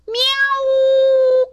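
very high, loud yowl; long pained cat screech; kitten's cry. A narrator's reading. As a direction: yowl like a cat whose tail is stepped on. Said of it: "cried Snowball".

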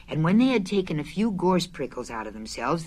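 usual speaking voice

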